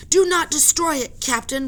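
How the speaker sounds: high, loud order; commanding roar. Acted. Professor roars at the captain, high and loud. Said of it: "roared the professor".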